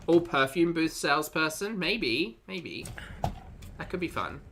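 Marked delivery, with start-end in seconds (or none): none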